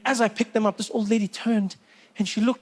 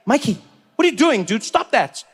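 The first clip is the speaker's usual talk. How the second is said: raised-pitch, indignant challenge; exasperated; 5 semitones higher, 3 dB louder than usual. Acted. Man turning round on Mikey in the cinema, exasperated. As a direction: pitch it up and demand he stop. Confronting the kid again.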